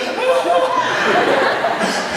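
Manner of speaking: loud, high laugh; high burst of laughter. Real, not acted. Speaker laughs at his own story, loud and high. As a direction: crack up at the joke.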